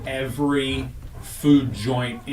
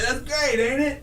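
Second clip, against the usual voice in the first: much higher, squeaky and pleased; high mock voice; 13 semitones higher than usual. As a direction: squeal it proudly. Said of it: "And I was like".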